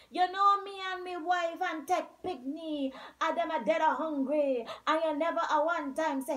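a starving man protesting loudly; hungry Zaki. A narrator's reading. Zaki voiced complaining.

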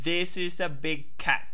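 slow, deliberate English read for voice recognition; careful English pronunciation. Shown as a demonstration. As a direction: say "This is a big cat" slowly, sounding out each English word clearly for the phone. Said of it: "yo voy a tratar de pronunciar la primera oración".

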